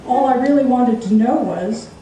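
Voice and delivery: ordinary delivery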